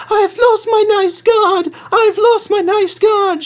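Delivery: very high mock sobbing wail. Clarabelle sobs to Thomas, high mock wail. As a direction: wail it like a weepy little coach. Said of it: "she sobbed".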